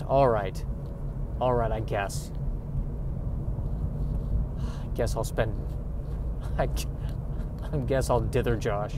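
mock reluctant sigh of settling, low and soft; reluctant resignation. Women out of options settle for Josh, mock resigned.

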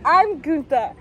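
high, loud hammed-up sibling intro; Gunther and Tinka. Friend playing two siblings introducing themselves, hammed-up. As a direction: shout it like a showy double-act introduction. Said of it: "Gunther and Tinka".

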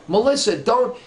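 high, alarmed retold warning; urgent warning. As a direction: try to stop her in time.

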